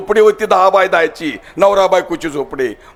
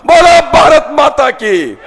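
slow, drawn-out rally chant, higher and louder; slogan shout; 7 semitones higher, 4 dB louder, slower than usual. Real, not acted. Politician calling the crowd to chant, rousing shout. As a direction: lead the crowd in a slogan at full voice. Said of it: "घोषणा द्या जोरदार".

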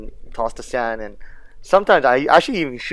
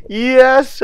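triumphant yes; 10 semitones higher, 6 dB louder than usual. Real, not acted. Successful shot on fish.

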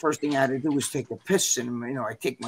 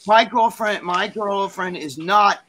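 mildly raised, emphatic refusal; indignant rejection; 4 semitones higher, 6 dB louder, slower than usual. Retold or imitated. Best friend refusing the contestant for her friend, indignant put-down. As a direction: lay down each refusal slowly and firmly.

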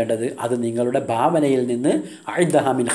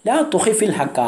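teasing line pitched higher; mocking; 6 semitones higher than usual. Sister mocking Fuad for hiding the truth, mildly teasing. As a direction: needle him with a higher mock tone. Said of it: "പരിഹാസത്തോടെ".

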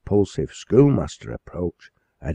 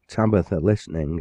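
usual speaking voice

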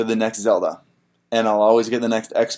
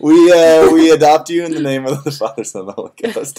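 loud hammed-up priest blessing; mock priest blessing; 6 semitones higher, 9 dB louder than usual.

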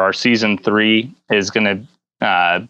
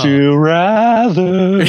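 announcer; 8 semitones higher, 4 dB louder, slower than usual. Pretend. Announcing game segment title.

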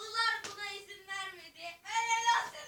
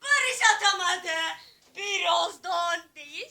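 loud commentary; 7 dB louder, slower than usual. Real, not acted. Missed shot.

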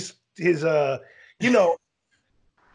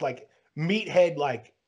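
ordinary delivery

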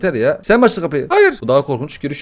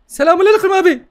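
much higher, cartoon baby greeting; squeaky baby voice; 5 dB louder than usual. Gamer voices the demon baby greeting him, squeaky. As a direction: play the cheeky devil baby.

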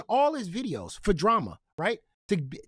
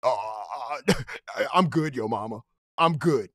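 mocking Cali's dodge; 9 semitones lower than usual. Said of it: "As soon as I said".

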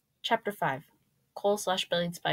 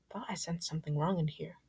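soft inner-thought voice; thinking to oneself; 6 dB quieter than usual. A narrator's reading. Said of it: "I thought to myself".

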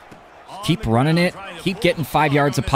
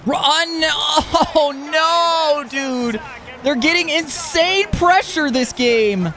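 panicked gamer yell; 13 semitones higher, much slower than usual. Real, not acted. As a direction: yell in panic as the pocket collapses. Quarterback under heavy pressure.

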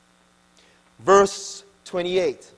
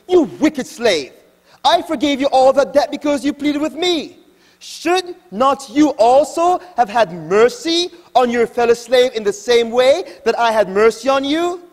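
higher, louder, an angry lord's rebuke; stern rebuke; 6 semitones higher, 6 dB louder than usual. Acted. Lord rebuking the unforgiving slave, louder and higher. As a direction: scold him from the throne, loud and high.

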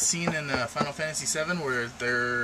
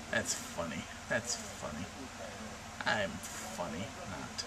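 low, soft mumble to oneself; deadpan self-mockery; 6 semitones lower, 10 dB quieter, slower than usual. Real, not acted. Vlogger judging their own randomness joke, quiet deadpan.

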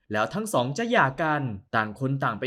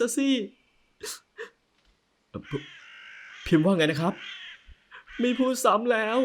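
high, light woman's voice; playful young wife; 9 semitones higher than usual. Acted. Wife teasing husband about meeting parents, high playful voice. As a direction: tease him in a higher voice.

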